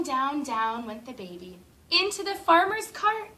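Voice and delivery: ordinary delivery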